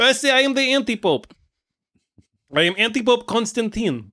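put-on high, accented self-important villain; hammed-up accented villain introduction; anti-pope Constantine. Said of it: "evil anti-pope powers on you".

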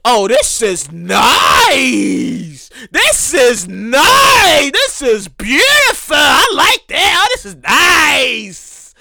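stretched-out awestruck wonder; amazed. Retold or imitated. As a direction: savour every word like a kid in a candy store. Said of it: "I was like".

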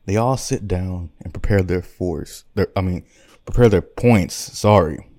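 ordinary delivery

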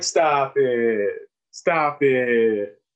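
drawn-out, falling "stop it", imitating a kid; bashful kids. Kids brushing off the doctor's compliment, bashful. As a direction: stretch each one out like an embarrassed student.

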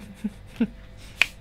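suppressed snort of laughter; trying not to laugh with water in mouth; stifled giggles. Real, not acted. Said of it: "What was that noise, bro?".

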